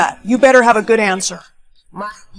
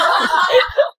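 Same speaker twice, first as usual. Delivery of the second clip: high-pitched burst of laughter; mocking laughter; 8 semitones higher than usual. Friends laughing at someone dressed in a new outfit, mocking laughter. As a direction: cackle high at someone's costume.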